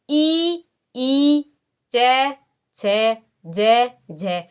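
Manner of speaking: ई, चै, छै, जै, झै stretched out one by one; slow letter demo. Shown as a demonstration. Teacher repeating palatal letters for students, drawn out. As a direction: pronounce each palatal letter very slowly. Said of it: "तो इसको जब हम बोलते हैं".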